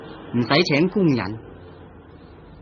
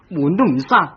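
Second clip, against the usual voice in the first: irritated scolding; 7 semitones higher, 4 dB louder than usual. Acted. Husband scolds careless wife.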